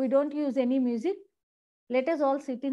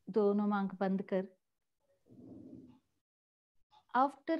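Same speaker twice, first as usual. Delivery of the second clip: guided meditation; 3 semitones lower, 3 dB quieter than usual. Real, not acted. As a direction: ease them gently into stillness, pausing between phrases.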